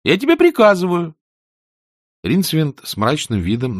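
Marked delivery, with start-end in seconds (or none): 0.0-1.2 high, loud, petulant order; imperious youngster. A narrator's reading. Boy insists his demon obey, imperious. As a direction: command it like a bossy boy.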